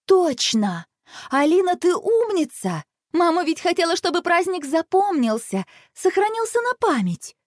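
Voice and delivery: high-pitched, excited brainwave; elated girl praising her little sister; sudden realisation. A narrator's reading. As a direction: burst out with the idea, pitched high. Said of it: "И вдруг её осенило".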